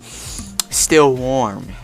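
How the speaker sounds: ordinary talk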